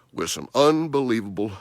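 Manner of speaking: normal talking voice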